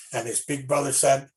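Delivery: ordinary delivery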